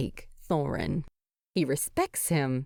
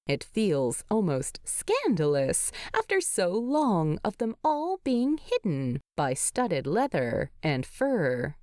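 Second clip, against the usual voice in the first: plain reading voice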